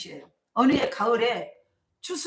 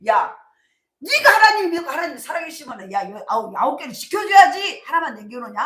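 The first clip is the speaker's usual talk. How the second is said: loud, higher scornful jeer; mocking jeer; 5 semitones higher, 8 dB louder than usual. Neighbours jeering at the farmer's faith, loud and scornful. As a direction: jeer at his useless prayer. Villagers mock the praying farmer.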